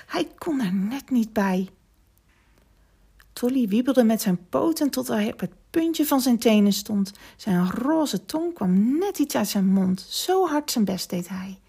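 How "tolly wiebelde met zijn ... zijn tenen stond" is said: ordinary delivery, nothing marked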